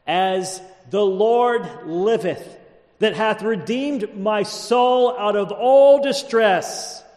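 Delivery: slow, high-pitched declaimed scripture; stately pulpit reading of a vow; solemn oath reading. A narrator's reading. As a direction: swear it deliberately, stretching the words. Reads the king's oath from scripture.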